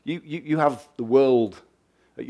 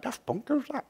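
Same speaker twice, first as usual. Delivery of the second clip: put-on high, softer old-person voice; puzzled old person; 13 semitones higher, 7 dB quieter than usual. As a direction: pipe it up like a baffled elder. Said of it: "The old people were like".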